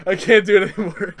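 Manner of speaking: words squeezed out through real laughter; trying to talk but cracking up; real giggling fit, barely getting words out; breaking up laughing. Real, not acted.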